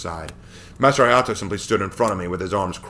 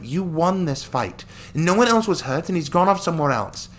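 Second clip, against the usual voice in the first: put-on encouraging girl voice; 10 semitones higher, 4 dB louder than usual. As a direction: lift him up as a cheerful friend. Melody encourages the hero.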